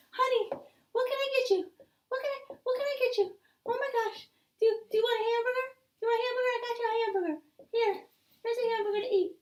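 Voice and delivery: a mom rushing in, fussing over her little one; mother hurrying to feed her hungry child; fussing mommy. Acted. Mom rushes in to help.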